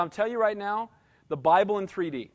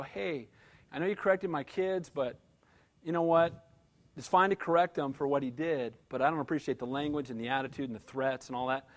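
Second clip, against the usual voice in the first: low, soft, restrained retelling; calm confrontation; 7 semitones lower, 7 dB quieter than usual. Retold or imitated. Father correcting man who threatened his kids, calm. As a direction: keep it calm and quiet while objecting. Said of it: "I was very calm".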